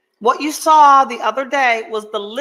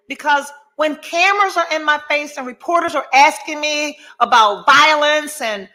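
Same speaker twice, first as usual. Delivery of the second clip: ordinary delivery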